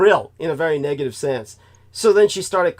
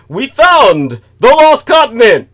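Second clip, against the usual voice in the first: mock announcement; 7 semitones higher, 13 dB louder, slower than usual. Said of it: "scientists come out and say".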